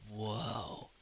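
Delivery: put-on a small child quietly awestruck; awed little boy. Little halfling boy looks up at the newcomer, quietly awed. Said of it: "very small and just looks at you and goes".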